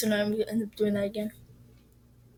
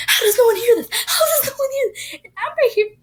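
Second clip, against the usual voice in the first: panicked; 11 semitones higher, 10 dB louder than usual. Retold or imitated. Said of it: "And I was like".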